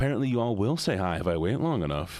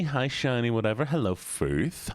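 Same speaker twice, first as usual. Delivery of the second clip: usual speaking voice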